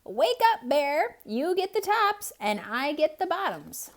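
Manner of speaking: high, loud wake-up call. Acted. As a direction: call across the field to sleeping Bear. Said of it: "Hare called out".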